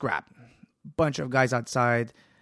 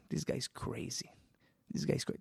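scared whisper; 10 dB quieter than usual. Retold or imitated. Scared gang muttering.

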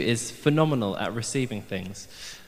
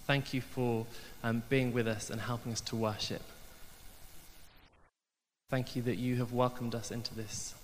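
softer, hushed prayer to Jesus; quiet prayer; 7 dB quieter than usual. Acted. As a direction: pray gently before the talk. Pastor prays aloud during sermon.

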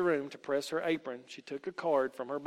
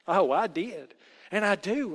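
put-on louder, emphatic child's protest; earnest exclamation; 5 semitones higher, 7 dB louder than usual. A narrator's reading. Rosie assures her aunt she is saved, exclaiming. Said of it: "exclaimed Rosie".

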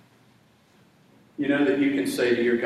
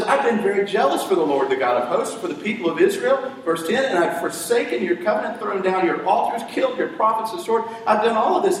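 high, louder pleading complaint; a hint of an aggrieved prophet; Elijah complaining; 7 semitones higher, 4 dB louder than usual. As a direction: lay out the grievance higher and louder.

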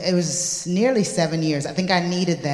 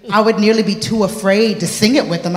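normal talking voice

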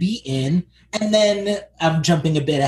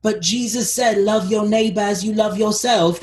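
higher-pitched preachy good guy; mock pious plea; 6 semitones higher than usual. Imagined good Paladin to zealots, pleading. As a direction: plead it high, like a well-meaning believer. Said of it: "No one who's like".

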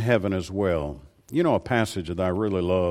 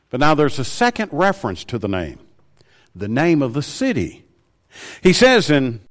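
usual speaking voice

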